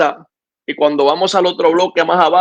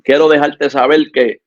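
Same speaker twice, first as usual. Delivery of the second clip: earnest, steady exhortation to the listener; calm sermon appeal to a struggling listener; preaching appeal; 3 semitones lower than usual. Acted. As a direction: plead with the listener to keep the faith.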